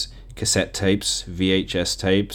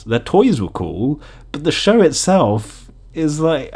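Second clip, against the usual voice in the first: ordinary talk, nothing marked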